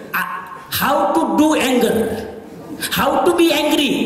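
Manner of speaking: slow, deliberate announcement; spelled out at a crawl. Shown as a demonstration. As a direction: stretch every word of the title. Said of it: "lecture title, drawn out".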